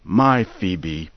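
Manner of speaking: ordinary delivery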